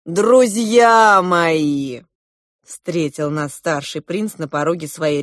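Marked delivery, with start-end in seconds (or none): 0.1-2.0 loud, hugely drawn-out welcome; booming slow welcome to dear friends; expansive greeting. A narrator's reading. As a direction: stretch every vowel as a grand host. Prince greets guests with open arms.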